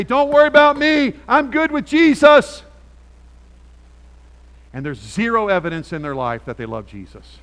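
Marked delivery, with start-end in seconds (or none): 0.0-2.6 high, loud, breezy mock quote; mocking relatives. Relatives dismissing the pastor's worry, in a high loud mock voice. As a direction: parody someone brushing off concern. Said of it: "friends that say".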